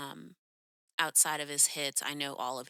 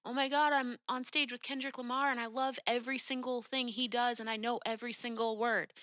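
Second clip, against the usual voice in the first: higher-pitched imitation of a starstruck fan; caught up; 6 semitones higher than usual. Fan on stage with her idol, imitated breathless gushing. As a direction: gush as if swept up in the moment. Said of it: "so caught up in the moment of".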